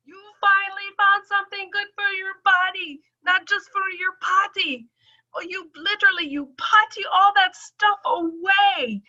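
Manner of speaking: high teasing doctor voice; high-pitched doctor. Retold or imitated. Doctor joking about her potty, high-pitched. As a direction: tease like the delighted doctor. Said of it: "that's when she said".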